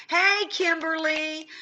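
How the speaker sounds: calling out. Real, not acted. Greeting a viewer by name.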